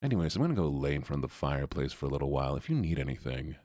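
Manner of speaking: the dog at home with his family, mellow; calm relaxed dog. Retold or imitated. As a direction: lounge like an old dog by the fire. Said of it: "He's like".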